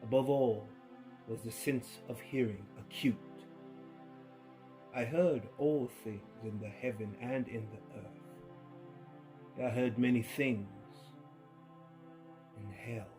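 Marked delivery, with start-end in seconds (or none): none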